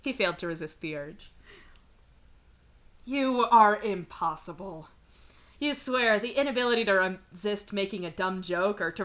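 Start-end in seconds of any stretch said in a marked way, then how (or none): 3.0-4.9 slow, loud mock despair; exasperated. Real, not acted. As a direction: sigh it out fondly. Exasperated at the character.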